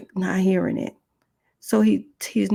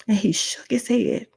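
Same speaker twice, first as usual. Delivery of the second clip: ordinary talk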